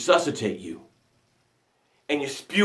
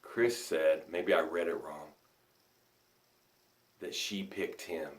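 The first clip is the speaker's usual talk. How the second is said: much softer, lower murmur; soft aside; 12 semitones lower, 17 dB quieter than usual. Real, not acted. Coach quietly addressing Chris during the breath, soft. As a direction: talk gently under the breath.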